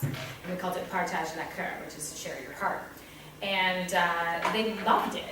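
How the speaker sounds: normal talking voice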